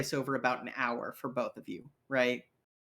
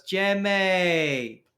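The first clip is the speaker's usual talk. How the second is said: over-friendly debt collector; 4 semitones higher than usual. Acted. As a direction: greet an old debtor with hearty false chumminess. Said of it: "kinda walks up and goes".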